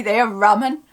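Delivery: said with a fond little laugh; amused, affectionate Suffolk remark; amused fondness. Shown as a demonstration. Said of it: "it then gets said in such a way".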